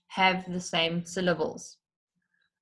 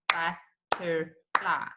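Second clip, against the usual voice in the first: but-ter-fly, slow and softer; clapped syllables; 4 dB quieter, much slower than usual. Shown as a demonstration. Teacher clapping three syllables slowly. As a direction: clap each beat of butterfly. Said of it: "how many times do I clap it out".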